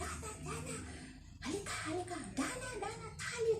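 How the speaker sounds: high mock yell of the boss; imitating boss yelling. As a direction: yell her name like the boss at the door. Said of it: "sumisigaw na siya". (ginagayang sumisigaw na amo)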